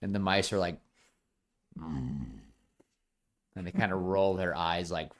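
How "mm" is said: low, quiet reluctant hum; begrudging mutter sound; mock grudging grunt. Mice grudgingly give in.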